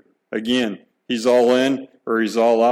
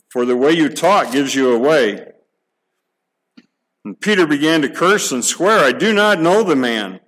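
raised, energetic Bible reading; scripture reading; 3 semitones higher, 3 dB louder than usual. Acted. Preacher reading Matthew to the church, lively and raised. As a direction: read the passage to the congregation with drive. Reads the denial passage aloud.

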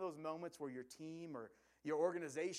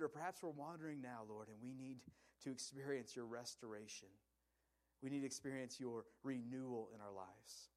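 low, softening prayer voice; 4 semitones lower, 3 dB quieter than usual. Real, not acted. Preacher praying for the congregation, in a hushed prayerful voice. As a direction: pray gently, sinking softer toward the amen.

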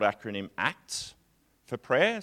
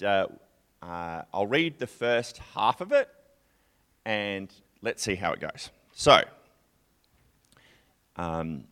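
usual speaking voice

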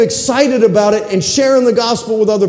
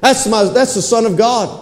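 normal talking voice

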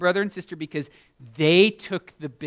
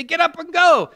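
higher, louder firm command; hammed-up angel command; angels insisting; 12 dB louder than usual. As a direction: order him out again. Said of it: "And they say".

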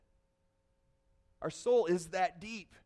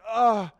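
frustrated groan; 3 semitones higher, 9 dB louder than usual. Retold or imitated. Frozen, mumbling on camera.

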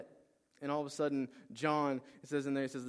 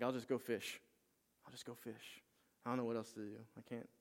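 resigned; 6 dB quieter than usual. Retold or imitated. Peter gives up, retold.

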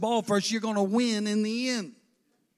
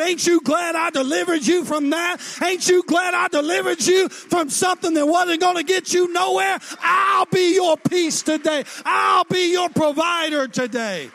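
high, loud proclaiming shout; voice of the Lord; 7 semitones higher, 5 dB louder than usual. Acted. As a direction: thunder it out as God's promise. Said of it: "I just heard the Lord speak".

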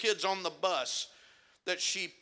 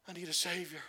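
hushed plea; 7 dB quieter, faster than usual. Acted. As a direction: let it fall to a near hush.

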